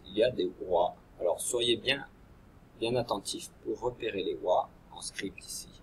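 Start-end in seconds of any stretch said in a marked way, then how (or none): 4.9-5.7 soft unvoiced whisper; whispered instruction. Real, not acted. Teacher whispering the task to pupils, hushed. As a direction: whisper it to the class.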